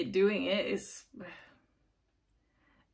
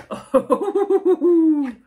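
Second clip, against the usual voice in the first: big real burst of laughter; loud laugh; 9 semitones higher, 14 dB louder than usual. Real, not acted. Reacting to a shocking card, loud real laugh.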